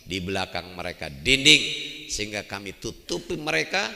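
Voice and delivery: normal talking voice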